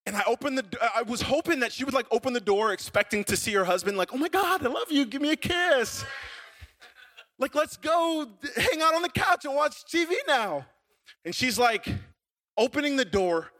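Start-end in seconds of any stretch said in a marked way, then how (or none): 4.1-10.6 high, gushing mock delight; imitating adoring wife. Wife greets husband at the door, hammed-up delight. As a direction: gush in a put-on high wifely voice.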